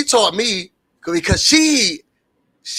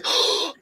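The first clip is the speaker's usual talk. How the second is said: soft breathy gasp of mock shock; 5 dB quieter than usual. Shown as a demonstration. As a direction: gasp as if caught off guard. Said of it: "And you have to say".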